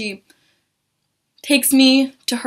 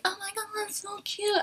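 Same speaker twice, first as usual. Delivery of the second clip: tiny, high, soft squeal; gushing; 11 semitones higher, 12 dB quieter than usual. Retold or imitated. Everyone online reacts to photo, high soft gushing. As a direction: mimic followers cooing, pitched way up.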